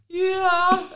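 put-on high, loud little-girl yeah; mock small-child yeah; imitating daughter's yeah. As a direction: squeak out a child's yes. Daughter's answer voiced.

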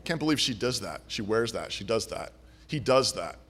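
tut-tut at everyone under your breath; gossiping critic. Retold or imitated.

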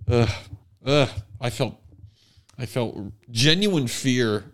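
usual speaking voice

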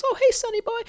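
put-on high quavery-free old lady falsetto; old grandmother. Seinfeld's grandmother on the phone with her bank, high old lady voice. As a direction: lift way up into a sweet old lady's voice. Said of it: "and she just says".

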